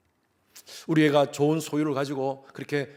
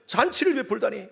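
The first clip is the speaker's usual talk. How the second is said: indignant complaint, higher and louder; older son protesting to father, higher and indignant; angry protest; 7 semitones higher, 4 dB louder than usual. Acted.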